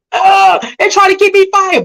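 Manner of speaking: very high, wailing fake cry; mock crying. Mimicking someone whose job got called, mock crying. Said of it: "you'll be crying right now".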